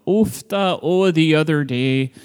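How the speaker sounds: lightly imitated Norwegian accent; a hint of a Norwegian grandma's accent. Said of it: "Super thick Norwegian accent".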